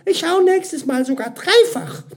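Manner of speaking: very high, loud parting promise; flustered retort. A narrator's reading. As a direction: call it back in a high, hurried-off voice. Mira calls back while leaving.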